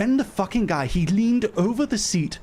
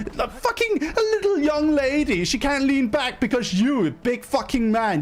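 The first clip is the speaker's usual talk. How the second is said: indignant rant; 9 semitones higher than usual. Real, not acted. Woman rants at the big man hogging space, indignant. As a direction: lean into each word, pitched right up. Ranting at the seat hog.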